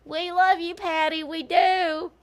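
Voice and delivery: high, sing-song mock adoration; mock fawning fans. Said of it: "They're like".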